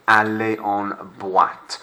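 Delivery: aller en boîte slowly, boîte as bwat; slow French model. Shown as a demonstration. Teacher models hard word for class, slow careful French. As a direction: stretch out aller en boîte for learners to copy. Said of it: "quite a difficult word to say".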